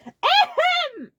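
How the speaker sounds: very high, very loud drawn-out ahem; shouted ahem; loud demanding ahem. A narrator's reading. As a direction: force them to notice you. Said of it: "Ludwig was demanding attention".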